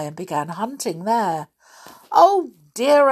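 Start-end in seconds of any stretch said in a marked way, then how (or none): 2.1-3.1 higher, louder fretful cry; flustered. Acted. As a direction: fret as if something's lost. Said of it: "fussing about".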